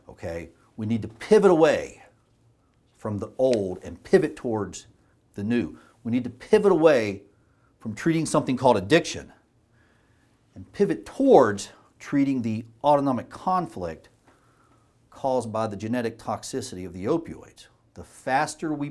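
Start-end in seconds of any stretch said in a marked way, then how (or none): 0.8-5.8 louder, pressing hard on "pivot"; rallying call. Real, not acted. 6.0-17.7 louder and higher, pushing "pivot" hard; impassioned call. Real, not acted. Repeats the call, building.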